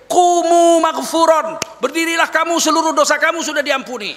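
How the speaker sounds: stretched high opening, then a ringing announcement; angel's cry from heaven. Retold or imitated. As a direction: cry it out like a herald from the sky. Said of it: "malaikat di langit menjerit".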